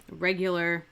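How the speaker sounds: usual speaking voice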